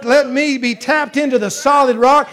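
shouting testimony from the pulpit; full-force preaching. Acted. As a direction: preach it out over the music.

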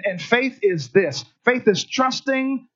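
ordinary delivery

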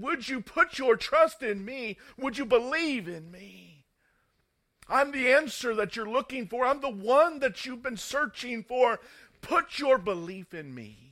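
loud, high-pitched appeal as Jesus; ringing, urgent sermon call; impassioned preaching. Acted. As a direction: plead for their trust with a preacher's full force.

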